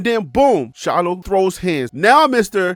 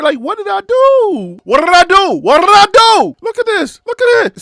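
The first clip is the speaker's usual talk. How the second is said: rising to a loud, higher protest, slowing on the repeats; Shiloh pleading; 7 semitones higher, 5 dB louder than usual. Retold or imitated. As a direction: build it into a hammed-up outraged plea. Voicing Shiloh pleading innocence.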